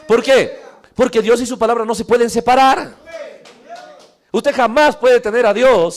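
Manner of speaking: loud, high preaching voice, full force; fiery preaching. Acted. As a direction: preach it out, shouting to the congregation.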